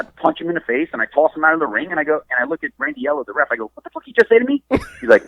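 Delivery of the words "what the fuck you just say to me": high-pitched retold challenge; incredulous demand. Wrestler retelling his challenge to a gasping opponent, incredulous. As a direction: demand it in mock disbelief.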